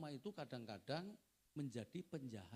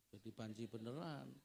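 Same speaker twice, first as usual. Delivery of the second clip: ordinary delivery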